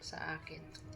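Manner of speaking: whisper. Real, not acted. Whispered ending.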